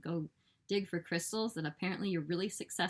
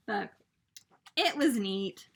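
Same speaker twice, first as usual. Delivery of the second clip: ordinary talk, nothing marked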